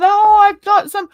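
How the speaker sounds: high, loud mock Yorkshire; attempted Yorkshire accent. Streamer announcing guests, hammed-up Yorkshire accent. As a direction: put on a bad Yorkshire accent. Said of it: "I can't even do a Yorkshire accent".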